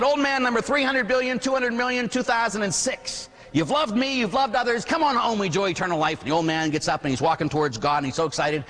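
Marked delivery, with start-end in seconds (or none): 0.0-6.2 high-pitched, grand roll-call announcement; booming judge God. Acted. God in judgment robes calling an old man into heaven, grand proclamation.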